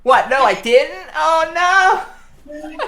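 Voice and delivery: high, increasingly loud indignant denial; mock protesting streamer. Streamer insisting to chat she said it right, mock indignant. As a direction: protest innocence, building to a loud dismayed wail. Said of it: "everyone would be in the chat going, "You said it wrong".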